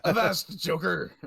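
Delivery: loud, high pirate call; mock pirate shout. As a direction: bellow it like a hammy pirate. Joins in the pirate impression.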